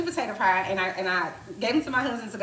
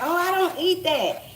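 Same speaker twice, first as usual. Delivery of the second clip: higher, louder, slow snooty whine; mocking picky eater; 9 semitones higher, 6 dB louder, slower than usual. Mimicking picky guests in a snooty high whine. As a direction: hold your nose up at the food. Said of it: "people be like".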